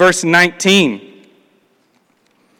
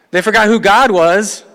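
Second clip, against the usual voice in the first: same words again, louder and rising on God; emphatic repeat; 4 semitones higher, 5 dB louder than usual. Acted. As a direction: hammer the phrase home to the church. Repeating the key phrase.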